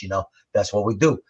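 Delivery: ordinary talk, nothing marked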